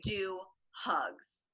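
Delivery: whispered punchline. Pretend. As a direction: hiss the last words under breath.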